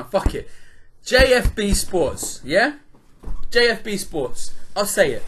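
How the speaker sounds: loud, slow, heated call-out; naming names at full volume; ranting. Real, not acted. Podcast host calling out a PR firm, loud and heated. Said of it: "That's the first rant we've had".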